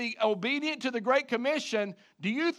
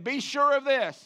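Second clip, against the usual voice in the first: firm, raised call with SURE punched; like you mean it; 6 semitones higher, 5 dB louder than usual. Shown as a demonstration. Preacher leading the congregation to say it like they mean it, emphatic. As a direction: say "Be sure of this" higher and louder, leaning on "sure". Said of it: "say it like you mean it".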